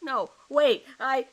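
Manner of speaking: loud, high, desperate cry; panicked protest. Acted. Suspect begging the detective to stop, loud and desperate. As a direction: cut in, begging him to stop.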